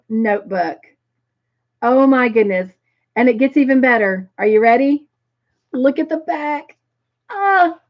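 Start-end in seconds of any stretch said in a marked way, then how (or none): 5.7-7.8 high, thrilled little squeal; real excitement. Real, not acted. Host showing off the notebook's back, excited. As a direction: let the pitch climb into a delighted cry. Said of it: "I am so excited".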